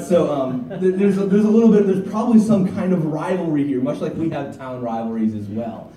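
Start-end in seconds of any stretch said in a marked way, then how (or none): none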